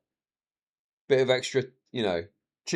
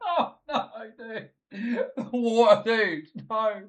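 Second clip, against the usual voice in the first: laughing; 8 semitones higher, 4 dB quieter, slower than usual. Real, not acted. Laughing at the disaster story.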